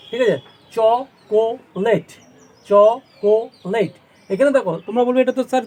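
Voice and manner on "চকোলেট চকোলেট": Chocolate very slowly as cho, ko, let; three separate beats in chocolate, a pause after each; syllable by syllable. Shown as a demonstration. Teacher showing three syllables to pupils, chocolate slowly broken up. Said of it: "chocolate তিনটে syllable হচ্ছে".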